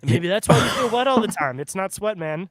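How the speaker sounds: ordinary talk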